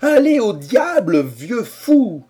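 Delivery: high, loud, slow furious shout; exasperated outburst. A narrator's reading. Harrison, exasperated, curses the old man. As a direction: snap back in exasperation. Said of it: "s'écria Harrison exaspéré".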